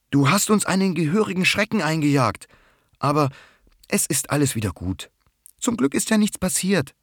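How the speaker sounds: put-on high, reassuring girl's voice; calming everyone down after the scare. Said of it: "beruhigt Rosi auch Mücke". (hohe, beruhigende Stimme)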